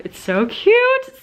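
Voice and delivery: high, loud, slow squeal of delight; gushing. Real, not acted. Showing off her new Kate Spade purse, gushing high and slow. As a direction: stretch it out with delight.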